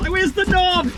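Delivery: spluttering as water hits; gasping under a water spray. Real, not acted. Editor sprays her with water.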